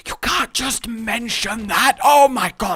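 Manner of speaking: high, loud mock outrage; mortified scolding. Companion scolding a rude friend in public, mortified. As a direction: scold a companion in shrill-pitched embarrassment.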